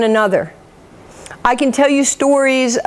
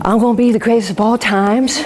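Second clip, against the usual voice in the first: put-on child copying the champ's boast; put-on boxing champion's swagger; Muhammad Ali. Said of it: "Why are you talking like that".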